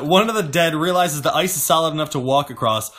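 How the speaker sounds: normal talking voice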